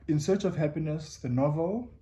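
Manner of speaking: ordinary talk, nothing marked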